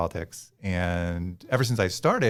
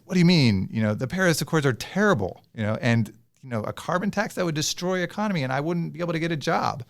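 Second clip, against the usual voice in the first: a hint of a huffy climate-skeptic bear pushing back; indignant polar bear; 7 semitones higher, faster than usual. Polar bear scoffs at the surfer dude, mildly indignant and higher. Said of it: "and then the polar bear says".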